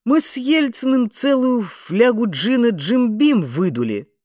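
high, loud, outraged bragging; worked-up braggart; indignant boast. A narrator's reading. Indignant Tom Dix keeps boasting.

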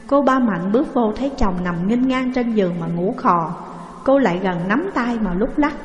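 ordinary delivery

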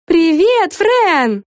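high, friendly greeting; cheerful editor. A narrator's reading. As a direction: greet her warmly. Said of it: "сказала Дарси". (приветливо)

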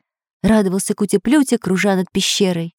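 plain reading voice

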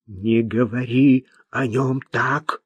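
slow, stern character voice; measured threat in a changed voice; menacing warning. A narrator's reading. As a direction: warn them off slowly and sternly. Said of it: "грозно произнесла Марта".

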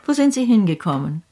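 ordinary talk, nothing marked

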